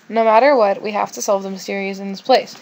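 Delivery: ordinary talk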